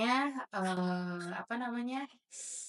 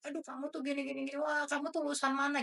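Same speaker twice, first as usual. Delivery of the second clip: higher, softer imitation of a scolding supervisor; understated retold telling-off, pitched up and softer; mock scolding; 6 semitones higher, 4 dB quieter than usual. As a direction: lightly act out a supervisor telling off a trainee. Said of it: "Dia marahin aku terus kayak".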